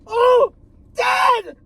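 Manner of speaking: loud, very high mock horror; hammed-up horror-movie shrieks; horrified screaming. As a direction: scream as if watching Dad get shot. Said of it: "Was that horrifying enough?".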